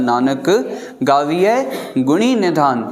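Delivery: Nanak, pause, गावीअ, pause, गुणी निधान. Shown as a demonstration. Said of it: "Nanak खां बाद बि विश्राम आहे". (नानक ऐं गावीअ खां पोइ विश्राम)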